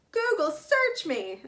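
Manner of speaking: high, loud, drawn-out shock; panicked exclamation. A narrator's reading. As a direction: gasp it out slowly in alarm, fully voiced. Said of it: "breathed in a panic whisper".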